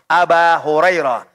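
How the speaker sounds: "Aba Hurairah" with long a ending Aba; alif reading. Shown as a demonstration. Teacher listing case forms, "Aba" with long a. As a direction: stretch the final a in "Aba Hurairah". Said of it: "Ada kalanya pakai alif".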